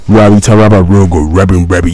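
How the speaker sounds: low-pitched put-on imitation. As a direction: push the voice down low. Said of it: "my voice is not even deep enough".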